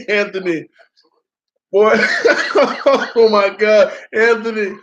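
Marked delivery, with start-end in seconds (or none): 1.7-3.9 drawn-out, laughing oh my God; slow, laughing oh my God; laughing in disbelief. Real, not acted. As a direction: laugh and groan at the thought. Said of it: "I would lose it".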